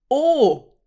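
high, loud cry of realisation; exclaimed "Oh". A narrator's reading. Nurek calls out on understanding Anuka's point, exclaiming. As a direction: call out as the point lands. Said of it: "rief Nurek".

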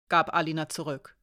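ordinary delivery, nothing marked

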